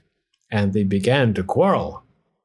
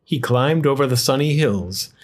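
usual speaking voice

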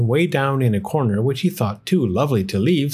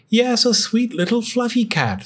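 much higher, lightly voiced old woman; a hint of a sweet old lady; old woman's voice; 11 semitones higher than usual. A narrator's reading. Old wife pleads with husband for a cat, higher voice. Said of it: "said the very old woman".

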